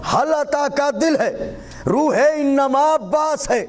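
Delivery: high, ringing declamation in measured phrases; devotional poetry recitation. Acted. Poet praising Abbas before the gathering, high devotional declamation.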